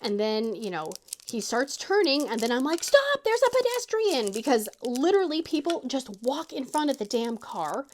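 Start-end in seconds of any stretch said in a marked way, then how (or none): 2.8-4.3 high, loud alarm call; a retold sharp stop call; urgent warning shout. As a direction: yell a quick warning to the driver. Warning the driver about pedestrian.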